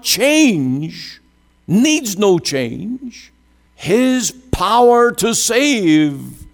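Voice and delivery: high-pitched, emphatic preacher's delivery; fervent preaching. Real, not acted. Preacher driving home Christ's unchangeable priesthood to the congregation, fervent. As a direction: preach it with rising fervour, stretching the key words.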